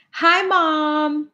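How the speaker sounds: high, drawn-out hello; sing-song greeting. Real, not acted. Host greeting mom joining the livestream, sing-song greeting. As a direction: call out high and stretch the last word.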